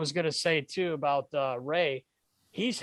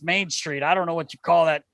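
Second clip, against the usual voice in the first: ordinary talk, nothing marked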